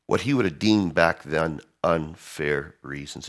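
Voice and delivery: ordinary delivery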